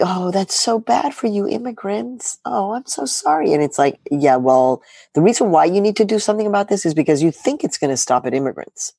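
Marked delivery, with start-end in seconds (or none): 0.0-3.5 imitating condescending sympathy; mock sympathy. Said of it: "people think".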